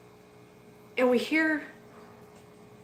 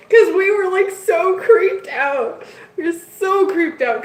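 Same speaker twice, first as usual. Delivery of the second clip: giggly; 9 semitones higher, 5 dB louder than usual. Real, not acted. Laughing recalling the fright.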